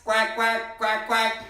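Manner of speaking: playful mock quacking for the kids; duck quacks. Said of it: "Can I hear some quacks?".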